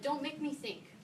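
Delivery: lifted pitch on "Don't make me think", "Don't" hit high. Shown as a demonstration. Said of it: "to quote the title of my favorite user experience book".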